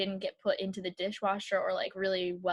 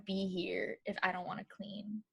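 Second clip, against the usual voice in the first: half-whispered confession; 2 semitones lower, 9 dB quieter than usual. Real, not acted. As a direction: drop to an unvoiced whisper. Quiet aside about cleaning.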